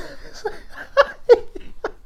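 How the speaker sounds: high breathless wheezing laugh; cracking up with a silent, high wheeze. Real, not acted. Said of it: "I swear I was dying".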